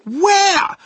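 high, loud questioning call; searching father's holler; baffled shout. A narrator's reading. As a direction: shout it in total bewilderment. Baffled dad shouts for hidden boy.

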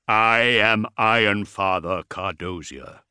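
slow, weighty war-machine voice; intoning bass dreadnought. A narrator's reading. Dreadnought introduces himself to the Primarch, booming intonation. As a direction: intone it slowly and resonantly. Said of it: "its bass voice echoing across".

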